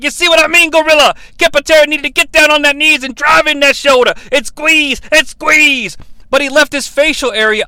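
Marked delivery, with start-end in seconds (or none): 0.0-6.0 lightly voiced, higher, louder wrestling commentator; a hint of an old wrestler explaining a hold; mildly hammed-up analyst; Superstar Billy Graham impression. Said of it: "Superstar Billy Graham's like".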